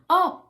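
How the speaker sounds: high, loud yawning oh; yawn sigh. Shown as a demonstration. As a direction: let out a big yawn sigh. Demonstrating a yawn sound.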